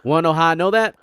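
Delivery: usual speaking voice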